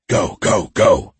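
hoarse croaked command; croaked urging. A narrator's reading. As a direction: croak out an urgent order. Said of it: "X croaked".

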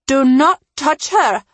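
high, forceful command; narrator's contained yell; yelled warning. A narrator's reading. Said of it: "Omi yelled".

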